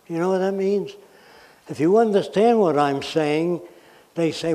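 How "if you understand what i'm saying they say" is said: ordinary talk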